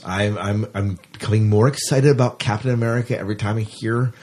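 ordinary talk, nothing marked

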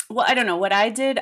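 ordinary talk, nothing marked

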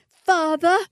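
high, loud cry for father. A narrator's reading. Matilda wakes and cries out to her father. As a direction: call out in alarm. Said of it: "she cried".

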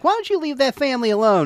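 put-on high, old lady scolding; imitating a nosy old woman. Retelling the old lady in the hallway, high voice. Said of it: "she comes out and she's like".

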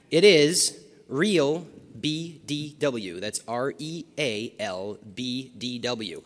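slow, deliberate dictation; spelled out slowly. Shown as a demonstration. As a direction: spell it out letter by letter. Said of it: "give you his Twitter account".

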